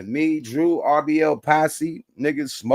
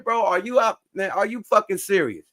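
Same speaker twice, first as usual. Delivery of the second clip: higher-pitched mock outrage at the guard; retold indignant protest; 8 semitones higher than usual. Arguing with the security guard at the gate, higher indignant retelling. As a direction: replay your disbelief in a raised, higher voice.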